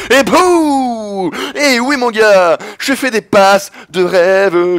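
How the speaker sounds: high, loud, slow gloating; triumphant cry. Real, not acted. Gamer gloating over a dream pass, loud and slow. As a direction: savour the moment out loud.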